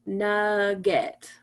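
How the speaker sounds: NUGGET drawn out evenly as nug-get; slow syllable-by-syllable. Shown as a demonstration. Teacher counting chin drops on nugget, slow and separated. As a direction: say nugget slowly, giving each syllable full weight. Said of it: "say the word slowly".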